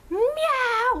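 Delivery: loud, high imitated cat yowl; cat scream. The cat suddenly screaming in labor, a loud high imitated yowl. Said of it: "Like, cat scream".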